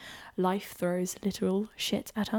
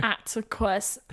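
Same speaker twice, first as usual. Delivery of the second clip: normal talking voice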